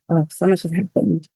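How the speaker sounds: usual speaking voice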